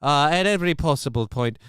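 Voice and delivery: high, loud mock Irish brogue; put-on Irish accent. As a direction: play a Scottish local whose accent slides into Irish. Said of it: "I don't know why I'm doing more of an Irish voice now".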